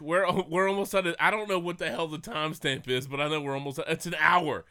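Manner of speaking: usual speaking voice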